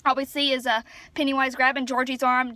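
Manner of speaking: ordinary talk, nothing marked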